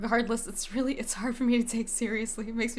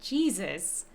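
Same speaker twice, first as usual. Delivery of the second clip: ordinary delivery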